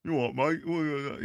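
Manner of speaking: a brash Cockney geezer, Cockney put on; playful fake Cockney; mock Cockney. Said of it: "those impressions".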